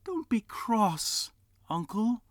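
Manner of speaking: high, slow soothing plea; gentle nephew. Acted. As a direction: soothe the grumpy uncle. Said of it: "said the nephew".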